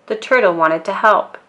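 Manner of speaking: plain reading voice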